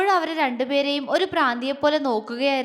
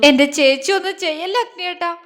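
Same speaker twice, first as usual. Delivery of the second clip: louder, higher pleading cry; tearful plea; 5 semitones higher, 6 dB louder than usual. A narrator's reading. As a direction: beg him to stop, in tears. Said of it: "സൗബി കരഞ്ഞോണ്ട് പറഞ്ഞു". (കരഞ്ഞുകൊണ്ടുള്ള അപേക്ഷ)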